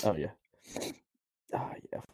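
soft breathy whisper, sarcastic. Real, not acted. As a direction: whisper it, barely there.

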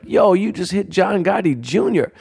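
called-out warning. Retold or imitated.

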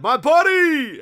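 high excited yell of greeting; excited shout. Retold or imitated. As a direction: shout it like greeting an old friend. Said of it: "Kevin Hayes was on the Zoom and said".

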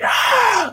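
high breathy mock crowd roar; imitated roar. Comparing a small audience with a big one, imitated roar. Shows how a crowd sounds.

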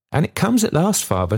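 pleasant voice, high at first, faintly sad; touch of sadness. A narrator's reading. Daughter asking father about the bells' promise, touch of sadness. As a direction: ask gently with a little sadness. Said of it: "with a touch of sadness in her pleasant voice".

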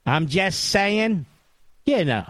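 lightly put-on voice of a rival host; mocking Joe Scarborough. Host introduces himself as Joe Scarborough, lightly mocking.

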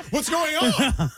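high, drawn-out mock alarm; alarmed tourist. Co-host acting a tourist hearing screams, mock alarm. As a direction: play a startled tourist, laughing. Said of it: "Tourists look immediately".

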